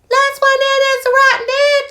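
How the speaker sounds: squeaky high chirp of a tiny chick. Acted. The chick joins the crowd in a very high voice. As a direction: pipe it up like a little chick.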